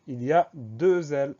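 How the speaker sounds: "deux L" stretched out and stressed; emphatic warning. Shown as a demonstration. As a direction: punch "deux L" as the key spelling point. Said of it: "Le gros point d'attention".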